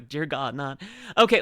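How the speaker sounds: normal talking voice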